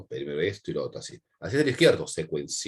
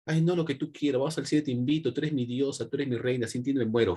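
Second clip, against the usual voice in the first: higher, simpering lover's plea; mock fawning suitor; 4 semitones higher than usual. Teacher mocks a beta male flattering his girlfriend, mock adoration. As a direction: imitate a besotted boyfriend offering everything.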